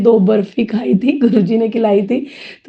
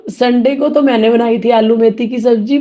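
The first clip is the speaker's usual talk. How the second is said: ordinary talk, nothing marked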